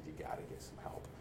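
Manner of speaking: hushed, near whisper plea; quiet plea. Retold or imitated. Family intervening, hushed plea. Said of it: "my family intervened on me and said".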